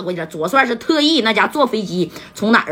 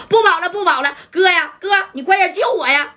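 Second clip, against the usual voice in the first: higher, giving up and begging; desperate pleading; 8 semitones higher than usual. Acted. Pei Gang caught by police, begging his brother desperately. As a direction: plead as they grab you. Caught fugitive begs on phone.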